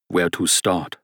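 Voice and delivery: put-on musing German-accented question to himself; pensive German accent. Rausch gathering his thoughts, pensive German accent. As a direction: wonder aloud in a German accent. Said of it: "said a man with a thick German accent".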